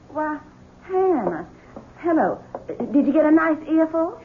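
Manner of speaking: high, soft, sweet mock greeting; sugary sarcasm. Wife catching the maid eavesdropping, sugary sarcasm. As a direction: coo it with false sweetness.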